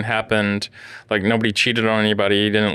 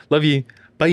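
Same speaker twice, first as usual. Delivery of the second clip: higher sing-song love you bye; imitating sign-off; 6 semitones higher than usual. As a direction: mimic his habitual goodbye. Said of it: "you're always like".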